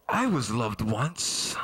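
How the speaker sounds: low, slow, defeated mutter; low depressed aside. Acted. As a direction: let the ad collapse into sadness.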